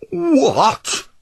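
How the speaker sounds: high, loud outraged bellow. Acted. Obstinate bellowing at Pliable's turn, outraged. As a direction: roar it in disbelief. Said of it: "bellowed Obstinate".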